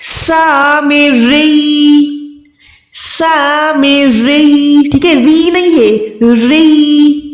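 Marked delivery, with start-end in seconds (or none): 0.0-4.9 सा में री drawn out very slowly with doubled या; correct recitation. Shown as a demonstration. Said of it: "कैसे अदा करना है?". (सा में री धीरे, शद के साथ)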